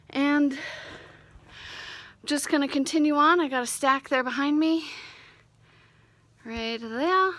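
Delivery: winded and loud after work. Real, not acted. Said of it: "I gotta catch my breath".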